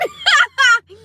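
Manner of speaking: high burst of laughter; loud laugh. Real, not acted. Speaker recalls going to bed, loud high laughing. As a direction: crack up loudly. Laughing at own childhood story.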